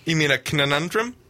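"conundrum" with an extra syllable, "kuh-nuh-NUN-drum"; K-N pronunciation. Pretend. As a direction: stutter the n in "conundrum" as a Viking word. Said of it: "begins with a K N sound".

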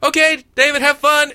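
high, loud yelled goodbye; mock yell. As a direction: yell a cheery send-off at full volume. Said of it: "I'm just gonna yell every time".

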